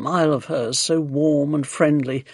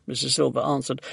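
ordinary delivery, nothing marked

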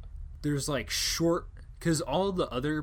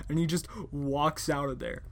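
usual speaking voice

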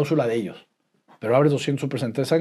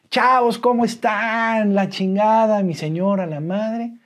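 put-on greeting the kids high and bright, loud; fingida alegría; 9 semitones higher, 4 dB louder, slower than usual. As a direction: put on a cheerful front for the kids. Saluda a sus hijos fingiendo alegría. (alegría fingida, más aguda y fuerte)

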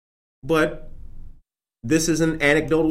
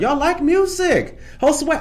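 excited shout-out; 13 semitones higher, 4 dB louder than usual. Real, not acted. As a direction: cheer the chat on like a hype host. Excited shout-out to live chat.

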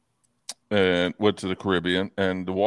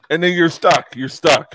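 ordinary delivery

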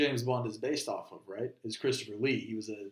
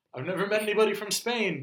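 ordinary talk, nothing marked

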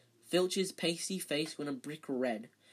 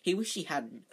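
usual speaking voice

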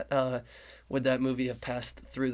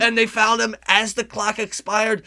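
dramatic announcer; 7 semitones higher, 7 dB louder than usual. Acted. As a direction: lean into the last-shot drama. Commentator calling final shots.

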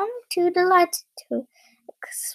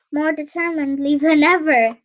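plain reading voice

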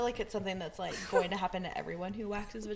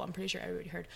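usual speaking voice

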